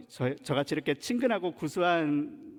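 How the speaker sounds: ordinary talk, nothing marked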